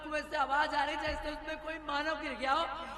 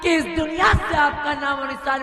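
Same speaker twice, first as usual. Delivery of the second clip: loud villain declaration; 2 semitones higher, 9 dB louder than usual. Acted. Villain reveals her plot loudly.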